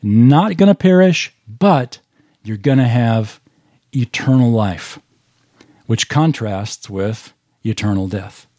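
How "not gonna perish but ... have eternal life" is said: emphatic contrast of perish and life; strong contrast. Shown as a demonstration. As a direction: hit Not gonna perish hard, then settle on eternal life. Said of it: "It is a strong contrast".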